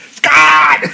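high, piercing shout of a name; imitated motherly yell. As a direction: yell for your son, mimicking the film. Mimicking the mother's yell from film.

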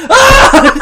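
high mock shriek; Creed scream. Creed screaming in the fire drill, high mock shriek. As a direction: shriek like a ghoul. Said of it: "Creed goes".

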